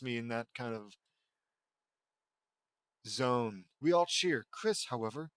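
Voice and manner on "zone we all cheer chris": normal talking voice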